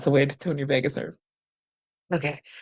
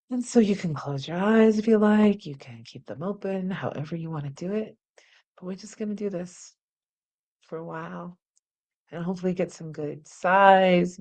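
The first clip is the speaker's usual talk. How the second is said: calm relaxation; 8 dB quieter than usual. Acted. Teacher guiding viewers through calming hug, softly. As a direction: ease them into rest.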